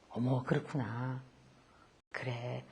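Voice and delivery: very soft, slow and low; tender murmur. Retold or imitated. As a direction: gently affirm a child, barely voiced. Tender response to child.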